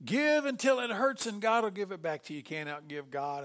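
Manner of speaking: loud, high preacher's cry; mock preacher. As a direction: ham up a big-tent preacher. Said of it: "You hear preachers preach".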